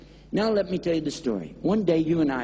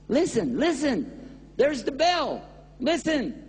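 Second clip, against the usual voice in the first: urgent plea; 8 semitones higher, slower than usual. Acted.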